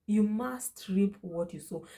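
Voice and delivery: usual speaking voice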